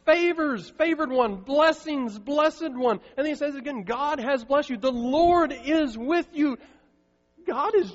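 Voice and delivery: loud, high, slow proclamation that keeps climbing; impassioned preaching. Acted. Pastor to the congregation about Mary, impassioned full-force preaching.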